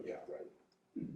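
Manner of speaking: breathy doubtful aside; sarcastic whisper. Retold or imitated. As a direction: scoff it under your breath. Skeptical inner thought retold.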